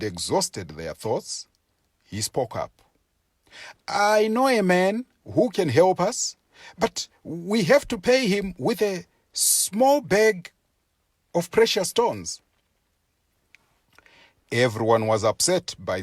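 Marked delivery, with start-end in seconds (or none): 3.9-12.4 a character voice pitched higher, a bit louder; sly persuasive hare voice, higher up; Tsuro speaking. Acted.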